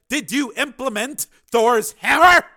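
high, loud, slow bellow; shouted demand. A narrator's reading. Commander questioning launch control, shouted demand. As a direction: bellow each word down the phone.

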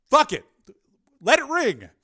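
high, loud, urgent dismissal; emphatic, frustrated outburst; exasperated shout. A narrator's reading. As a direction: blurt it out, desperate to ignore it. He urges ignoring the phone.

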